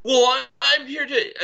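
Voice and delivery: flustered lady. Retold or imitated. Mimicking the lady's flustered reply.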